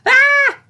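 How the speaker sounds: high, very loud startled scream; sudden scream. A narrator's reading. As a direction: shriek as she goes under. Filly suddenly sinks.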